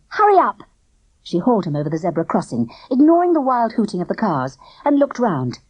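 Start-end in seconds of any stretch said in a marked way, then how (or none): 0.1-0.6 high, loud hurry up; urgent shout. A narrator's reading. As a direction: yell at him to move. Girl dragging him along.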